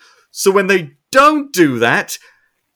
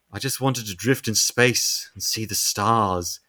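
soft, low, slow and wistful; wistful lament; 8 semitones lower, 7 dB quieter than usual. Retold or imitated. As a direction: sigh it out like a lonely creature. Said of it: "Starro's just like".

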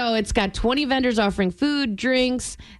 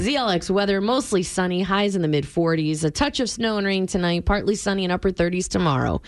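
weather read; 4 semitones lower than usual. Acted. Reads the station weather.

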